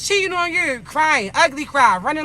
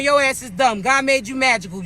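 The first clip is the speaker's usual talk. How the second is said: ordinary talk, nothing marked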